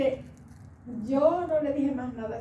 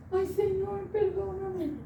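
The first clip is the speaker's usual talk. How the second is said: mild retold cry; 7 semitones higher than usual. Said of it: "empecé a dar grito".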